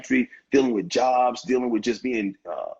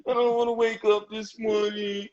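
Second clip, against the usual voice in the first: high, whining mock complaint; whiny complainer; 10 semitones higher than usual. Man mocking a whiner to his host, high whine. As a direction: whine like you can't face the morning. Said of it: "whine all the time".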